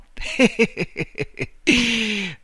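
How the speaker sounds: long high staccato cackle; laughter. A narrator's reading. Man lying on the floor laughs at Marta, cackling. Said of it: "E rise là".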